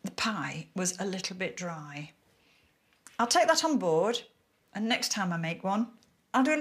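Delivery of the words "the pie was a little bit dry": soft whispered aside; whispering. Real, not acted. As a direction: whisper it like a secret. Repeats criticism in whisper.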